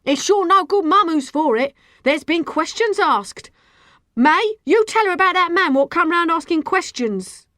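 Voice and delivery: high, loud retort; heated street-row accusation; angry yelling back. A narrator's reading. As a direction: lose your temper and yell back. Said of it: "lost her temper and yelled back".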